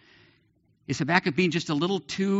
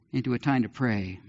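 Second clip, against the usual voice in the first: ordinary delivery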